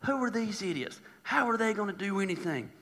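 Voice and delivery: hushed, scornful muttering; quiet dismissive mockery; mocking. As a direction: softly ridicule them, under your breath. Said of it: "Just mocking them, ridiculing".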